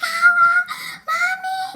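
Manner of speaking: put-on very high, loud child's wail; screaming little cousin. Little cousin holding her finger, imitated piercing scream. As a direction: imitate a hurt child shrieking for mom. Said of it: "One of my little cousins starts screaming".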